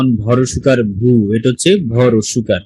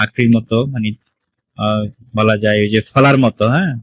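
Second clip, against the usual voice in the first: normal talking voice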